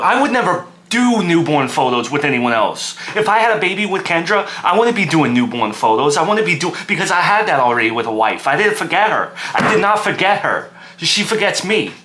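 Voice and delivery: high-pitched, wounded protest; agitated outburst. Acted. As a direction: build into indignant, emphatic insistence. Son's agitated outburst about his wife.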